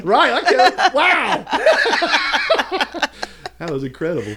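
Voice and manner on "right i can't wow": high, words squeezed through laughing; breaking up laughing; real helpless laughter. Real, not acted. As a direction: crack up while trying to speak. Cracking up at the mishap.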